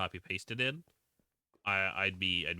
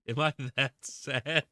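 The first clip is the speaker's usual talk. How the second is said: laughing; 6 semitones higher, 7 dB louder than usual. Real, not acted. Laughs mid-question, slowed.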